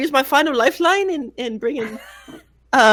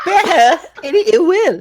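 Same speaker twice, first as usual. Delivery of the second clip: repeats the phrase louder and higher, laughing; 6 semitones higher, 4 dB louder than usual. Acted. [name] laughing while retrying her line to the table. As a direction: get the words out while cracking up.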